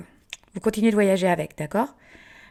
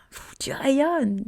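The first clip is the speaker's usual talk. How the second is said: high, drawn-out breathy admiration; slow, breathy gasp of admiration; awed compliment; 6 semitones higher, much slower than usual. Acted. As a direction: puff out and stretch it in amazement. Acts others' admiring compliment.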